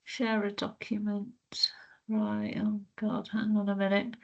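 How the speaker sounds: near-whispered, flustered self-talk; muttering to self. Real, not acted. Presenter hunting for the document to share, muttering to self. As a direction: think aloud under the breath. Struggling to share her screen.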